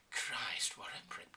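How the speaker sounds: breathless whispered grumble; men tutting in a hushed whisper; whispered aside. Acted. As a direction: breathe it out under your breath. Men muttering behind prince's back.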